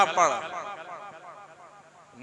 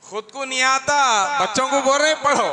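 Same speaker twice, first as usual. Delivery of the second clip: raised, incredulous jab at the father; mock indignation; 10 semitones higher, 8 dB louder than usual. Preacher mocking the father who can't recite, raised and incredulous. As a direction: scoff at him for making the kids read.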